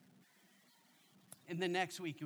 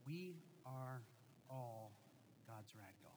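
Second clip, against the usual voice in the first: hushed sincere; 4 semitones lower, 9 dB quieter, slower than usual. Real, not acted. Sermon bottom line, hushed.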